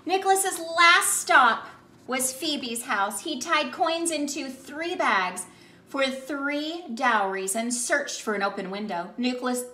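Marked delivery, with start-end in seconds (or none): none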